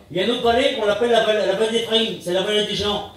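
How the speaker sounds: ordinary talk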